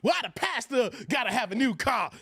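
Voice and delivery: ordinary talk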